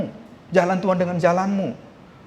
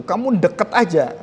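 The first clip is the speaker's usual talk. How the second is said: normal talking voice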